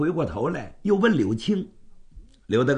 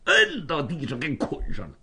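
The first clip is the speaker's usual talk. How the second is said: ordinary delivery, nothing marked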